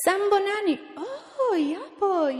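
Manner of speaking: high, lively exchange of greetings; animated retold greeting. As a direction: act out a delighted welcome. Acts out greeting and delighted reaction.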